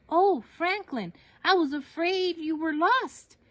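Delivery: mother's voice, relieved, calling out to her son. Acted. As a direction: sound like a mom who just found her lost child.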